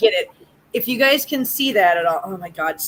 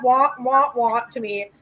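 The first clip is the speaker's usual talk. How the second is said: ordinary delivery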